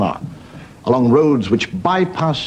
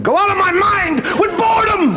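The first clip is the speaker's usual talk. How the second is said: shrill American lady. Acted. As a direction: wail it like a bored old lady.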